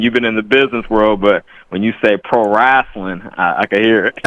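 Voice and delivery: usual speaking voice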